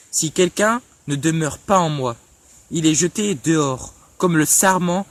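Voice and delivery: emphatic, measured Bible reading; scripture reading. Real, not acted. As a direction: declaim the verses phrase by phrase, with weighty pauses. Reads Bible verses aloud. (lecture biblique solennelle et appuyée)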